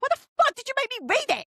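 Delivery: very high, fast exasperated squawk; mock outrage. Reader confronting cousin over a bad recommendation, mock outrage. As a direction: demand an answer in a high, incredulous rush.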